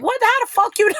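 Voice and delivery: high-pitched mock disbelief; squeaky-high retold shock, about to laugh; imitating his startled look. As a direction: act out being caught off guard, amused. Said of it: "He was looking like".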